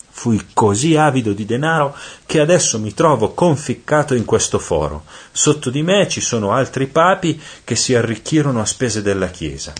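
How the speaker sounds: high-pitched, theatrical cry of a sinner. A narrator's reading. Damned pope confesses to Dante from his hole, crying out. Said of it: "quello gridò".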